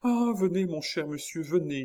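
high-pitched, urgent invitation; eager welcome. A narrator's reading. Chon grabbing the young man, eagerly welcoming. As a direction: start high and usher them in eagerly.

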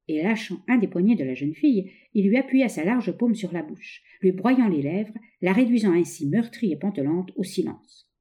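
plain reading voice

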